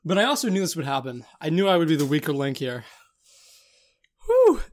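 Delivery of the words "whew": sharp high whew. Real, not acted. Exhale from pepper burn.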